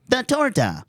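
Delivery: squeaky Pokedex entry voice; high mock announcer. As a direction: chirp it out like a Pokedex. Playful Pokedex voice.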